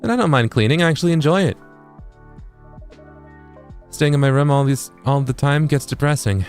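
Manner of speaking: high, earnest character read; upbeat helper character. Acted. Voices a game character.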